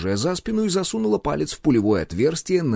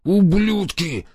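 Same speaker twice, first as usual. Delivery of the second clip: slow, strained threat through gritted teeth; 9 semitones higher, much slower than usual. Acted. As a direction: squeeze the curse out slowly. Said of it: "сквозь зубы процедил он".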